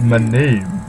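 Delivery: lightly hammed-up rolling celebrity voice; George Takei voice. Cohost continues Edward's line in a mock celebrity voice. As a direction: keep the mock grand actor delivery going. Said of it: "his voice is just George Takei".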